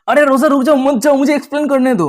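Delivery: urgent plea to stop. A narrator's reading. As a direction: plead urgently with someone who is leaving. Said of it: "रोजा को रोकते हुए कह रहा होता है". (घबराकर रोकते हुए)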